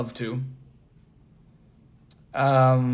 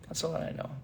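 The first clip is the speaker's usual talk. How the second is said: unvoiced whispered repeat; 2 semitones higher, 15 dB quieter, faster than usual. Real, not acted. Vlogger admitting his German runs out, whispered. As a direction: whisper the line to yourself.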